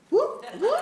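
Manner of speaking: very high loud whoop; real excited team whoop; cheering whoop. Real, not acted.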